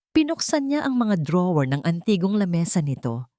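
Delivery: usual speaking voice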